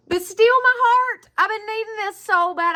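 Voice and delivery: gush, hand on heart; real rush of delight; overcome. Real, not acted. Touched by the gift.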